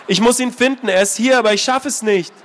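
insist, close to breaking; desperate girl. Acted. Anna telling the old man about Ben, desperate.

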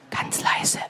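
soft unvoiced whisper. Shown as a demonstration. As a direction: breathe it, barely audible.

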